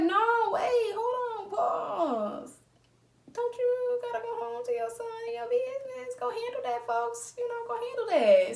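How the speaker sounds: high, whiny half-crying plea; coy mock-distressed squeal; flustered protest. Flustered pushing him off.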